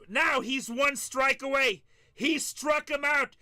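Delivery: high, loud, slow build on "one strike away"; milk each word like a radio call of the final out; Gary Cohen announcer call. Retold or imitated. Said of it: "'cause I get to talk like that".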